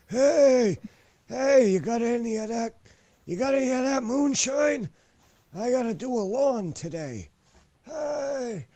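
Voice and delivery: high, far-off hammed-up old geezer calling out; a cranky old man begging for moonshine. He mocks his own old-man back as an old geezer, high and hammed-up.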